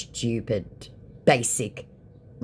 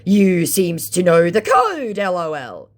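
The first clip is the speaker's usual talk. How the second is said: mock scammer voice; 6 semitones higher, 7 dB louder, slower than usual. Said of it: "thinking again I was a scammer".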